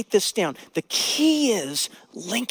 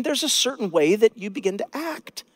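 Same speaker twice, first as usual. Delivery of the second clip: usual speaking voice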